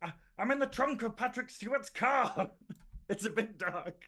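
high-pitched mock phone confession; imagined phone-in caller. Co-host imagines Mark phoning in, high mock caller voice. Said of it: "to phone in and just be like".

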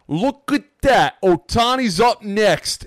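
slow, high, loud Italian New York impersonation; Italian New York accent. Retold or imitated. Imitating the fan back with a signed ball, Italian New York accent. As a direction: drag it out like an Italian New Yorker. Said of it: "stereotypical most Italian New York dude".

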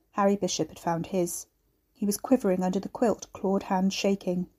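usual speaking voice

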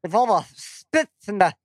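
lisping cartoon duck with spitting; Daffy Duck voice. Shown as a demonstration. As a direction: spit out the words like Daffy. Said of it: "explain it like you're Daffy Duck".

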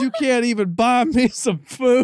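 loud, high mock angry dad; high, loud put-on dad voice scolding his son on the phone; dad impression. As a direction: bellow it like an outraged old man. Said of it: "Is that his accent too?".

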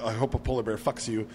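ordinary talk

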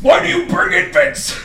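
high, loud, slow cry of complaint; mock exasperated yell. Moviegoer about a noisy baby at Transformers, mock exasperated. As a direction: wail it out in hammed-up exasperation. Acted outburst at parents.

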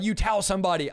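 normal talking voice

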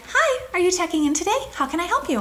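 high, chirpy front-desk greeting; hotel receptionist. Retold or imitated. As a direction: imitate an eager check-in clerk.

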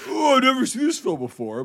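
high sarcastic mimicry of a know-it-all; mocking friend's voice. Mocking a friend who claimed he'd never seen the film, pitched high. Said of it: "You're like".

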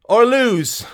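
high, loud demand to a crowd; shout. Acted. Mary pressing the crowd to choose, high loud shout. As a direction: shout for one united answer. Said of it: "shouted Mary".

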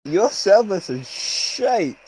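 high, slow put-on complaint; complaining customer. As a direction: drag out the mock grievance. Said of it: "You just switched roles".

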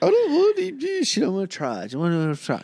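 high-pitched mock complaint, half laughing; mocking imitation. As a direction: mimic a picky eater, starting very high. Mocks partner refusing deep dish.

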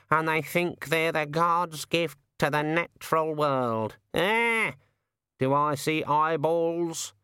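slow, high squawking bird voice; drawn-out, high raven rant; indignant raven. Acted. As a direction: grumble like an aggrieved raven, stretching the words. Raven's held voice, ranting.

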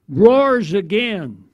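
normal talking voice

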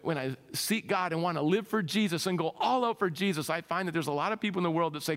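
ordinary talk

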